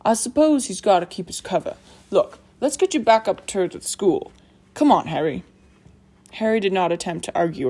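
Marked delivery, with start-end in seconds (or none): 0.0-5.4 confident big man, taking charge; hearty, emphatic character voice; Hagrid. Acted. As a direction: reassure firmly, then hurry a friend along.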